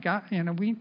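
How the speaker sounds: soft, fast pleading prayer; mild retold prayer, soft and quick; retold tearful prayer. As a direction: pray it softly, a touch of tears. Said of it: "we're crying and praying".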